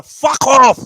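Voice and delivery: loud furious shout. Pretend. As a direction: snap it out in rage. Role-play ex shouting.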